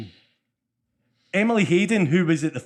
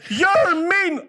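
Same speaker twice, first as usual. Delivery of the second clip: put-on high, louder hurt little girl's cry; upset child; 4 dB louder than usual. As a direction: wail it like a crushed kid. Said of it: "and Ruby went".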